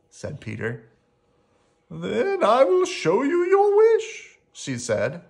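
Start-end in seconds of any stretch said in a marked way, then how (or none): 1.9-4.4 very high, slow, loud quaver; high, drawn-out frightened character voice; shaking voice. Acted. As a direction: say it slowly, trembling with fear. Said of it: "Her voice was shaking".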